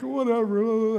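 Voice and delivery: high, loud imitation of carefree boys; mock the boys brushing dad off; mimicked kids. Laughing boys ignoring dad's threat, mimicked dismissively. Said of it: "they're like".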